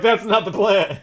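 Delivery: loud, high-pitched mock protest; exclaimed objection. Player character objecting to the botched ambush, exclaimed objection.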